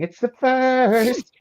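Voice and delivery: drawn out, high and loud; high mock announcer. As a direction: announce it like a game show. Announcer joke.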